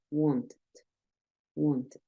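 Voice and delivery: soft, low "wanted" with a full "ed" ending; ED clearly sounded. Shown as a demonstration. Teacher repeats the example for learners, "wanted" with sounded ED. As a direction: keep the ED audible in "wanted". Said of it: "Esa E D suena prácticamente igual".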